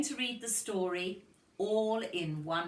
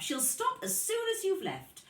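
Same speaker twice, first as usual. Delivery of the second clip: granny voice; 14 semitones higher, 3 dB louder than usual. Acted. Granny reassures parents.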